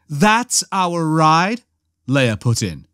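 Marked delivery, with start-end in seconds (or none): 0.1-1.6 slow, higher question on "That's our ride"; incredulous echo. A narrator's reading. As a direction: stretch "That's" and rise in disbelief on "ride". Leia's incredulous echo of Han.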